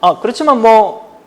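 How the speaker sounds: usual speaking voice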